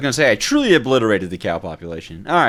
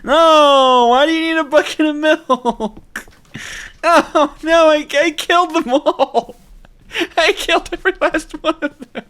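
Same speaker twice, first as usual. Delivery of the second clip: high, loud laughing wail; laughing disbelief; 6 dB louder than usual. Real, not acted. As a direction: cackle in horrified disbelief. Laughing despair at killing cows.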